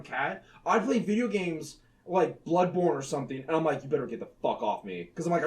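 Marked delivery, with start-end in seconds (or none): 3.8-5.1 much lower, softer warning; low growl. Retold or imitated. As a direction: growl it at the clingy cat. Telling cat off while gaming.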